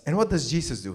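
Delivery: ordinary delivery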